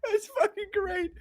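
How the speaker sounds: high, soft squeak through laughter; helpless laughter. Real, not acted. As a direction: barely get it out while laughing. Helpless after long laughing fit.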